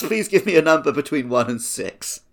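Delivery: normal talking voice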